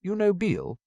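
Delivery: put-on Fleur's French accent turning Bill into "Beale"; lightly French-accented question. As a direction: say "Beale" with a long ee like a French girl. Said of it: "sorting out the accent in his head".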